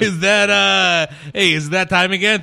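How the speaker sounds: pizza guy voice. Acted. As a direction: play a brash pizza delivery guy. S1 plays Pauly the pizza guy.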